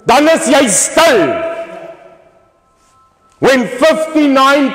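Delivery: high, loud, outraged oratory; full-force rally shout. Real, not acted. Speaker protests daily killings to rally, outraged full-force shout. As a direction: thunder it high at the whole crowd.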